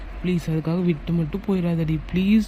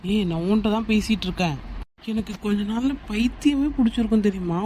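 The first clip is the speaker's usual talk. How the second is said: ordinary talk, nothing marked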